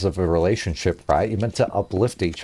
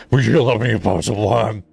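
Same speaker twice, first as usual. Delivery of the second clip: a hint of partner voice. Partner asks the worm question.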